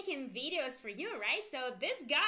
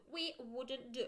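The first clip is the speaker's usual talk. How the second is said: ordinary delivery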